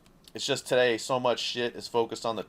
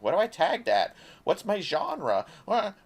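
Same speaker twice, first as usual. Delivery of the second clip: mock robot voice; 9 semitones higher, 3 dB louder than usual. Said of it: "It's like".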